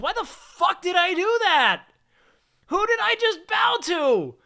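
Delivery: high, slow, drawn-out mock outrage; incredulous regret. Streamer retelling bowing to a stranger, incredulous regret. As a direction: stretch each question out high in disbelief.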